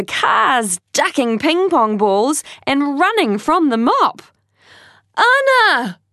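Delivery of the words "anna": high shout over noise. Acted. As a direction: yell a name across a hall. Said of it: "Tai shouted over the noise".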